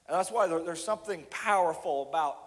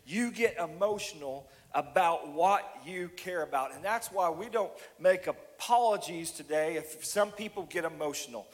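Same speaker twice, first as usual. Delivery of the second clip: ordinary delivery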